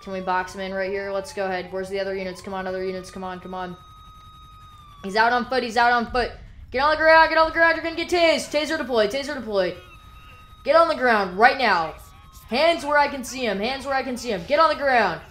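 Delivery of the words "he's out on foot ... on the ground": loud, high barked orders; roleplay cop bellowing at a suspect to surrender; police shouting commands. Pretend. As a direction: yell at the runner to get down. Cop yells at suspect on foot.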